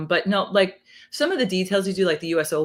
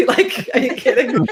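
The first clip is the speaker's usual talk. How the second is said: louder through a laugh; 7 semitones higher, 7 dB louder than usual. Real, not acted. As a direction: laugh it out in disbelief. Laughing at Natalie Portman story.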